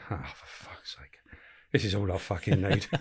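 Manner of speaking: weary whispered swearing under breath; mock despair, hushed; muttered curse. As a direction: groan it to yourself, fed up. Weary groan at news of boxer.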